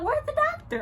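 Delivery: high, urgent yelp of a question; frantic demand. Retold or imitated. In labor, calling for the missing doctor: high frantic cry. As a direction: call out desperate for help.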